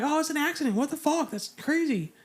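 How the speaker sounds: retelling a shocked reaction. Retold or imitated. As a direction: react like you just heard terrible news. Sailors assuming an accident.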